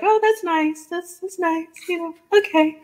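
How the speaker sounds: high, lightly mocking, faintly bored "that's nice"; polite disinterest. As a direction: imitate people brushing off the podcast pitch, high and politely uninterested. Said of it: "and everybody's like".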